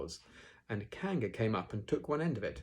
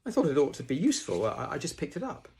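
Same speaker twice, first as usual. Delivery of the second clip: high, quick, hesitant bear voice; Pooh explaining; 7 semitones higher, 6 dB louder than usual. Acted. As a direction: explain modestly in a raised, hurried voice.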